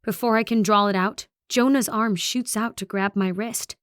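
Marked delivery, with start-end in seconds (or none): none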